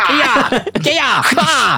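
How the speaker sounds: high sharp kiai yells; martial arts shouts. Retold or imitated. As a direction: yell each strike like a fighter. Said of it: "каждый свой удар, сопровождали". (выкрики боевых ударов)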